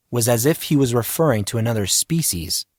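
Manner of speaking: usual speaking voice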